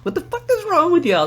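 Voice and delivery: high-pitched mock disbelief; exaggerated baffled protest; incredulous complaint. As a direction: question them in a rising, high voice. Retells disbelief at bad dancers.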